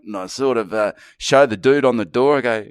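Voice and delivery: ordinary delivery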